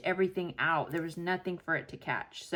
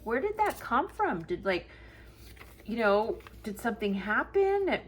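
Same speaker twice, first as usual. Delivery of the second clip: retold surprise; 5 semitones higher than usual. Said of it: "And I was like".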